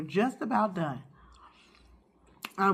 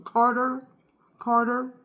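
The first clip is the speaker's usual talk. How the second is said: calling out the name loud; 6 semitones higher, 5 dB louder than usual. Real, not acted. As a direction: call across the room for Carter.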